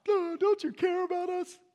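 high, loud panicked cry; panicked fishermen. Retold or imitated. Preacher voicing fishermen begging Jesus in the storm, panicked. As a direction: squeal it like scared fishermen in a storm. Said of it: "tough fishermen freaked out".

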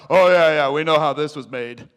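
loud, cocky know-it-all voice; mock the scientists with loud swagger; smug scientists. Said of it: "They're trying to figure out".

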